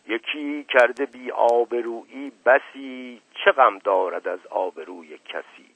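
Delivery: slow, measured recitation of a couplet; recited verse. A narrator's reading. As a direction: declaim the line of poetry.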